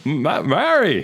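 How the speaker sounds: slow, high stammering movie-hero impression; Jimmy Stewart. Shown as a demonstration. Film fan imitating Stewart calling his sweetheart, slow stammering impression. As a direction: stutter out the name, drawn out. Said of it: "that's all I can do".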